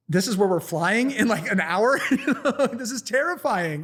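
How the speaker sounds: high, incredulous, laughing protest; mock terror breaking into giggles; laughing alarm. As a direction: retell your alarm through laughter. Retold alarm while laughing.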